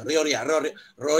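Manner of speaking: ryo repeated, practising the r; r drill. Shown as a demonstration. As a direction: roll through ryo again and again. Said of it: "R to iu moji ga ienai".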